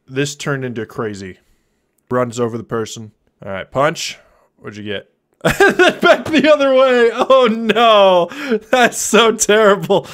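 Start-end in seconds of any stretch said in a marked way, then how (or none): 5.4-10.1 high, drawn-out words through laughter; real helpless laughter bubbling through the words; laughing. Real, not acted. Streamer reacting to a friend's terrible drawing, laughing hard.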